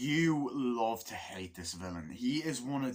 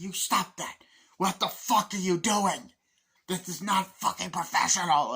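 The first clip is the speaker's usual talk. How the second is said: high, loud, emphatic scolding; acted outraged reprimand; 6 semitones higher, 6 dB louder than usual. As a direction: imitate an officer dressing someone down. Acting officer confronting Barnes.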